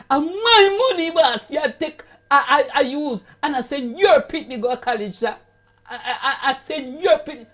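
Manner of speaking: 1 indignant beggar. Retold or imitated. Beggar protests to the boss.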